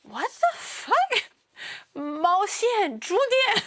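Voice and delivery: high-pitched mock disbelief; baffled local. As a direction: act a local totally thrown by nonsense. Local baffled by nonsense words.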